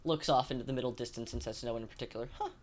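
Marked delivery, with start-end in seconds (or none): 2.4-2.5 oblivious aside. Acted. Oblivious character remark.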